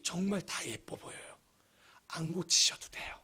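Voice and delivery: whispered reassurance, barely voiced; soft whisper. Pastor whispers comfort to the congregation, hushed and tender. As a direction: breathe it gently, like a secret comfort.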